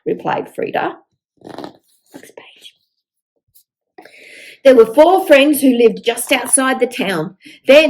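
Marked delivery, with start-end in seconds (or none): 2.1-2.8 whispered page cue. Real, not acted. Page-turn whisper to child.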